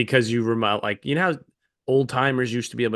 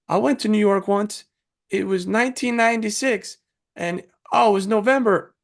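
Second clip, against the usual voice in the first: put-on higher, fussy old-timer reciting dates; rambling driver; 8 semitones higher than usual. Driver recalling his New York trip to passengers, high nostalgic voice. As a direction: recite the dates like a proud oddball, pitched up. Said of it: "he's like this".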